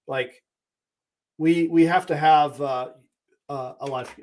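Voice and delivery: fighting back tears; choked up. Real, not acted. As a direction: voice catching with emotion, slow. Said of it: "I'm about to choke here".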